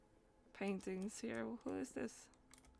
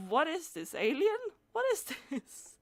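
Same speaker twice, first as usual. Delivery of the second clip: amazed surprise; 6 semitones higher, 4 dB louder than usual. Real, not acted. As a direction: gasp it out at the weird alien. Spots strange alien picture.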